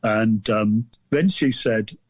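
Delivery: normal talking voice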